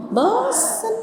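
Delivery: high mock bored whine; child's sulky whine, pitched high.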